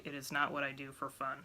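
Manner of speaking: ordinary talk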